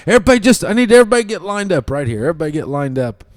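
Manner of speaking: high, loud and fast commands; line everyone up now; barking orders. Retold or imitated. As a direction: coach hurrying parents into line. Coach ordering lineup.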